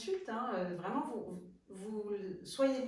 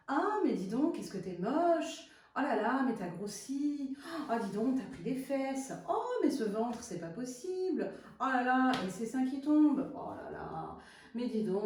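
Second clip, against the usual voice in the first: petite voix méchante; 3 semitones higher, 5 dB louder than usual. Acted. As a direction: play a mean, nagging little voice, exclaiming in mock horror. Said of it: "Celle qui est méchante, mesquine". (petite voix intérieure méchante et mesquine)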